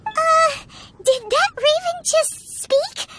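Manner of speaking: high, slow, stunned question; startled disbelief. Acted. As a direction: ask haltingly, taken aback by the raven. Startled by a talking raven.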